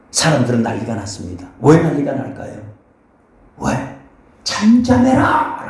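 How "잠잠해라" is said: slow, drawn-out hushing command; preacher voicing the scolding crowd, slow mock rebuke. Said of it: "라고 얘기를 합니다".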